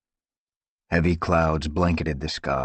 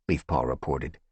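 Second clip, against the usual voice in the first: plain reading voice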